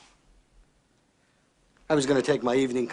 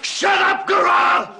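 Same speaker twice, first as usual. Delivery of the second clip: high, roaring command; explosive rage at an interruption; furious shout; 9 dB louder, much slower than usual. Acted. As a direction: scream him into silence. Boss silences his interrupting man.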